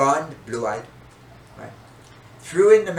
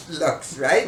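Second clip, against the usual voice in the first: usual speaking voice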